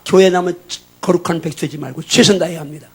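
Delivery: loud, emphatic exhortation; forceful preaching. Real, not acted. Pastor urging students not to idle, forceful preaching.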